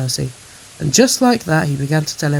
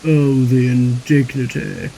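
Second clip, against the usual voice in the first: slow, loud, affronted groan; wounded pride, drawn out; 3 semitones lower, 5 dB louder, slower than usual. A narrator's reading. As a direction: moan it pompously, dragging the words. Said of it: "groaned Gordon".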